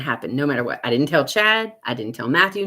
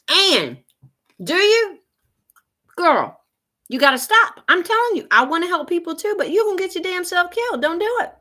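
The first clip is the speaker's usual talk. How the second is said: high, loud pleading warning; urgent plea; 13 semitones higher, 5 dB louder than usual. Real, not acted. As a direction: beg her to stop, voice way up. Pleading with chatter Ann.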